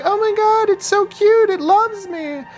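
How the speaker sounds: very high squealy gushing fan voice; high mock gushing over a cute snake; gushing new owner. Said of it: "they're like".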